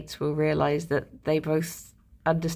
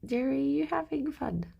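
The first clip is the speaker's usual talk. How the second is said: higher, softer voice to the pet; pet talk; 6 semitones higher, 5 dB quieter than usual. Real, not acted. Owner coos at her pet Jerry, higher and soft. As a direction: coo at the pet.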